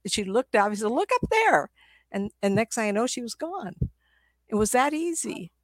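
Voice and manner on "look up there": much higher, excited pointing; high urging. Retold or imitated. Client urging the others to look up, high and excited. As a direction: urge them to look up eagerly.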